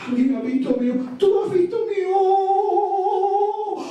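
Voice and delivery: lamento cantado, agudo y lento; elefante llorando. A narrator's reading. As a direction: gime buscando su ojo perdido. (el elefante llora buscando su ojo, voz aguda y lastimera)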